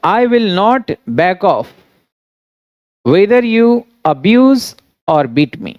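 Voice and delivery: very slow, word by word with long pauses; slow dictation. Shown as a demonstration. Teacher giving students the English translation, slow dictation. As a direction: dictate the sentence as it is written.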